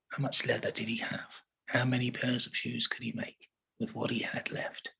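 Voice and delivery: hushed, whispered quiz question. Shown as a demonstration. Teacher quizzing children in a whisper. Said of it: "teacher asks quiz question".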